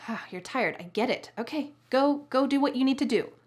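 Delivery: sighed, grudging go-ahead; annoyed. Shown as a demonstration. Wife lets tired husband go, annoyed. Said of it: "with an annoyed tone of voice".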